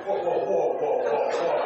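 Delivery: high, loud acted alarm; loud, high string of startled whoas; mock alarm. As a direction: call a halt in mock panic. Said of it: "I mean, I locked up".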